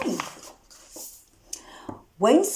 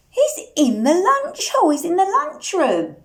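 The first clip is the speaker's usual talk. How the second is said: ordinary delivery, nothing marked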